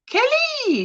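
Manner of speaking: calls the name out high and thrilled; excited winner call. Real, not acted. Announcing the prize winner to viewers, thrilled call-out. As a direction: sing out the winner's name like a reveal.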